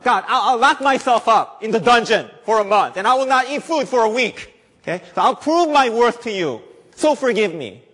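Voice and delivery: high, loud, laughing bargaining; mock bargainer. Preacher mocking a sinner bargaining with God, high and loud. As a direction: ham up a sinner cutting a deal with God.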